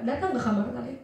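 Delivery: ordinary talk, nothing marked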